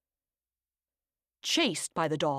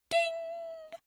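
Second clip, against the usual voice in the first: high bright ding; ding sound; 4 dB quieter than usual. Acted. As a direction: sing out the oven timer. Oven timer imitated.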